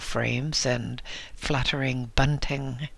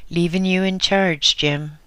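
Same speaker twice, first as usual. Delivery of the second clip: higher and louder call; 5 semitones higher, 4 dB louder than usual. A narrator's reading. Steve calling to his assistant while driving off, loud call. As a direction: call it across the yard. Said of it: "he called to his assistant".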